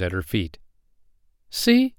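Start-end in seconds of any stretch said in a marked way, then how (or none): 1.5-1.9 very high little exclamation; eager cry. A narrator's reading. Poppy Pink drawing attention to her wings, high cry. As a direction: pipe it up high. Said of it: "she cried".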